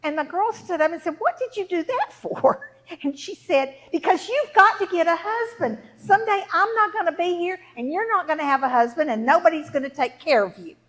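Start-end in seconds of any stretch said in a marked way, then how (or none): 1.2-2.7 high, loud outraged question; indignant protest. Acted. Girl asking mother why she hit her, high indignant protest. 3.9-10.8 loud, heated lecture; angry mother. Acted. Angry mother lecturing daughter about her future, loud scolding. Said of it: "she got very angry".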